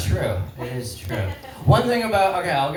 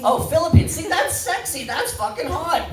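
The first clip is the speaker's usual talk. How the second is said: high, delighted swoon; excited; 11 semitones higher than usual. Real, not acted. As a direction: gush over the answer. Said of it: "crowd answer sets off excitement".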